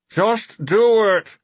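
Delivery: slow, high muffled voice. Acted. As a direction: talk muffled from behind cardboard. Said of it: "came the muffled voice of Auburn Stash".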